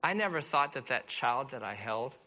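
soft, hushed, heartfelt; tender appeal. Real, not acted. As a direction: speak gently to the hurting.

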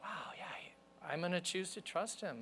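near-whispered, awed retold resolve; hushed acceptance. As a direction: let it out softly, almost all breath. Retelling a moment of surrender to God.

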